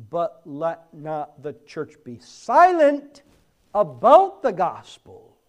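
loud preaching that swells to a cry; forceful exhortation. Real, not acted. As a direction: charge the church with full voice.

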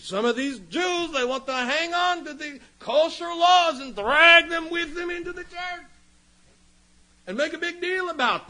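high, loud, exasperated mockery; mock the fussy legalists at a high pitch; scornful send-up, voice pushed way up; mocking outburst. Mocks legalists fussing over kosher laws.

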